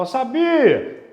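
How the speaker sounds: a mock triumphant shout; mock loud exclaim. Teacher mocks a student shouting in the exam, loud and slow. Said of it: "vai falar".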